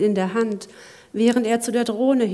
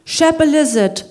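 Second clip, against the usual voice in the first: higher, louder, drawn-out call; 6 semitones higher, 7 dB louder than usual. Acted. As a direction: call the destination to the driver. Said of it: "rief Lachlan".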